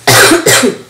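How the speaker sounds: harsh smoker's cough; coughing fit. Real, not acted. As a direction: cough hard, chest rattling. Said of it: "so I'm really wheezy".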